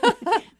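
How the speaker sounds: high, loud burst of laughter; real laugh. Real, not acted. Widow laughing about taking four months, loud laugh. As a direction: let a bright laugh burst out.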